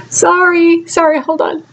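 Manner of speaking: high, tearful apology; apologizing through tears. Real, not acted. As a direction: pull yourself together mid-cry. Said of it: "I just had a little crying spell".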